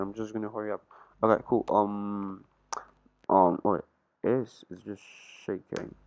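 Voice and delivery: normal talking voice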